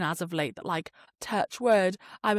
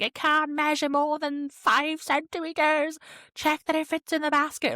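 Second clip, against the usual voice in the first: mock-officious recital of the rules; officious bag checker; 8 semitones higher than usual. Mimics fussy airline bag checkers.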